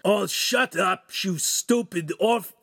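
loud, high, slow irritated curse; groggy angry outburst cut short; cursing. A narrator's reading. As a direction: swear crossly and break off mid-word. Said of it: "Ugolino's curse stopped short".

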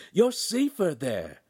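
high, slow insistent cry; alarmed companion crying out advice; urgent cry. A narrator's reading. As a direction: draw out a high, urgent warning. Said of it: "Pan cried into Handsome's ear".

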